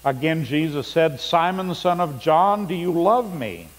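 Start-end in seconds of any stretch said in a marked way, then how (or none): none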